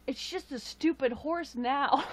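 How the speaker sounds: mock a little girl's heartbroken grumble; upset child quote. Retelling the niece's upset over the unicorn horn, a mock sulky whine. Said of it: "she got upset, she was like".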